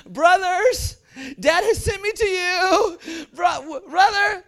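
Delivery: high, loud, crying plea; desperate pleading. Acted. Joseph crying up to his brothers from the pit, high and loud. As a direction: beg from the bottom of the pit.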